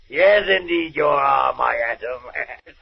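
slow, high, laughing delight; villain crowing with glee; gloating. Acted. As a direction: savour it through a wicked chuckle. Gloats over his Atom Man.

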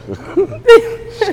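high-pitched burst of laughter; high squealing laugh. Real, not acted. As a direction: let out a squeaky giggle. Laughing at own toilet story.

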